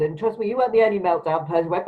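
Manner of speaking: normal talking voice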